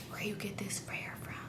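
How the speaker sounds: very soft whispered question; hushed, nosy funeral whisper; retold whisper. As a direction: imitate whispering to a seatmate mid-service. Chatty seatmate during the funeral.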